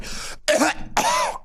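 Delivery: sudden high fake coughing fit; fake choking cough. Mock choking cough after quoting Zuckerberg. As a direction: pretend to choke on the words. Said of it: "I just choked on Zuckerberg's words".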